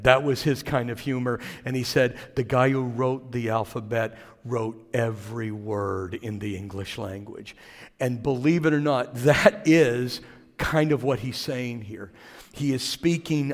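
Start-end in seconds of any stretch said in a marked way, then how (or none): 2.3-7.5 low, soft deadpan monotone fading away; Stephen Wright monotone. Retold or imitated. Preacher quoting the comedian's alphabet joke in monotone. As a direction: deliver it dry and lifeless, trailing off. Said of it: "He was a monotone, um, comedian".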